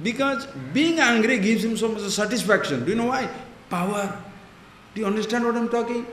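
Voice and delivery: ordinary talk, nothing marked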